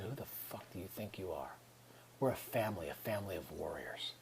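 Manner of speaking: hushed, intense self-scolding; fierce whisper to himself. Retold or imitated. A man scolding his reflection in a fierce whisper. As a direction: hiss at yourself in the mirror, low and urgent.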